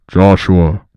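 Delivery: low, booming call of the name; deep Foog voice. Acted. Foog summoning Joshua, low and booming. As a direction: call him like a god from above. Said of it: "I figure out how to lower my voice".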